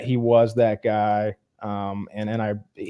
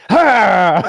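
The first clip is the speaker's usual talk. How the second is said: sharp laughing "Ha!" that jumps out louder; sudden startle shout; 6 semitones higher, 8 dB louder than usual. Retold or imitated. Friend imitating Joker scaring the dwarf, sharp loud laughing "Ha!". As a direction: bark it to make someone jump.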